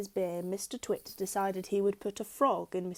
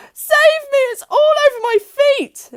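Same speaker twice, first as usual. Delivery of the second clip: very high, loud terrified shriek; panicked scream; 17 dB louder than usual. A narrator's reading. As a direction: squeal it in terror. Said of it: "screamed Missus Twit".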